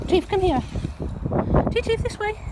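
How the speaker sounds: high, loud call across the park; calling the dog. Real, not acted. Owner calling her dog Chief across the park, loud high call.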